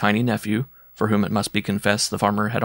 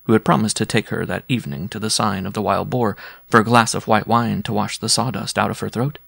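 usual speaking voice

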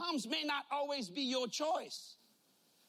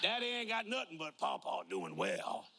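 deep drawl grandkid; 6 semitones lower than usual. Retold or imitated. Grandkids quoted.

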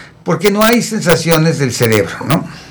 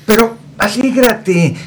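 higher, drawn-out cajoling; coaxing encouragement; 6 semitones higher, slower than usual. Acted. Relatives telling a sick patient to cheer up, mock coaxing. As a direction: imitate well-meaning visitors urging someone to perk up. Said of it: "Y luego les dicen".

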